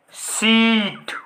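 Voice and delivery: सीट with the ee drawn far out; long vowel. Shown as a demonstration. Teacher demonstrating long sound to students, drawn-out ee in सीट. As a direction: stretch the vowel in सीट very slowly. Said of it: "जिसको बोलने में हमें ज़्यादा sound खींचना".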